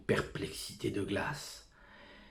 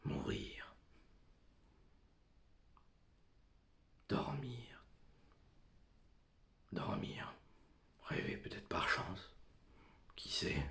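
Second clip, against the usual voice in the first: soft, unvoiced whisper; dreamy whisper; 3 semitones higher, 7 dB quieter than usual. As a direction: whisper it as if drifting to sleep. Soliloquy, dreaming of death.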